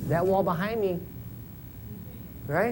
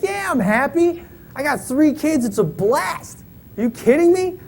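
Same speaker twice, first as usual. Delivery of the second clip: excited; 9 semitones higher, 4 dB louder than usual. Retold or imitated. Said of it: "I'm like".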